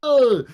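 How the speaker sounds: sharp high cry of alarm; high loud yelp. Retold or imitated. The pitcher slipping on the mound, a high yelp. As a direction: yelp as he slips again. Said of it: "he's like".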